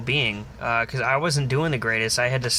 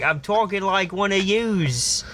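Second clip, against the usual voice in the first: slow, higher, hammed-up Aussie insisting; fake Australian accent; 4 semitones higher, slower than usual. Man defending his fake accent to the blokes, slow hammed-up Aussie. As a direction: drawl out the claim in an exaggerated Aussie accent. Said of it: "I'm like".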